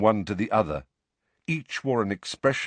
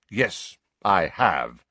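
slow, firm pride; Sirius, proud; 2 semitones lower, much slower than usual. A narrator's reading. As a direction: answer slowly and proudly. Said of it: "said Sirius proudly".

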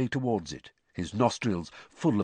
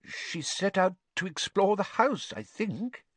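nervous; 9 semitones higher than usual. A narrator's reading.

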